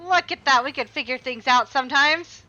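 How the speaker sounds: excited celebration. Real, not acted. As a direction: cheer at your own cleverness. Puzzle solved, celebrating.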